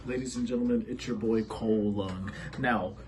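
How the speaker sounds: ordinary delivery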